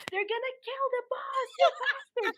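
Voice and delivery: high-pitched alarm tipping into laughter; mock panic. Worker reacting to threat against the boss, laughing mock panic. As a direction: cry out high in mock dread.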